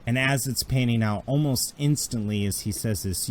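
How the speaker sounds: ordinary talk, nothing marked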